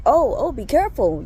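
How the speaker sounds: retold startled call to watch out; alarmed warning. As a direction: call out in concern as someone trips. Startled warning to a tripping man.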